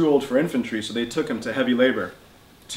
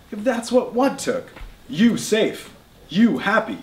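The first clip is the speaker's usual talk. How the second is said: much higher, rising in disbelief; incredulous outburst; 9 semitones higher, 3 dB louder, slower than usual. Acted. Slava to grandfather in disbelief, high rising outburst. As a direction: throw his words back at him.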